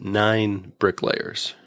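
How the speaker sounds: ordinary talk, nothing marked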